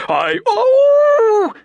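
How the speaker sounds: high, loud wail of agony; agonized cry; anguished cry. A narrator's reading. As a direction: cry out like a lost soul. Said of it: "The cry was wrung from Ardita".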